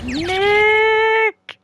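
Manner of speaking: long, high holler on one note; shouted name. Acted. Nick trying to set off the camera, shouted name. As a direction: yell it loud and hold it.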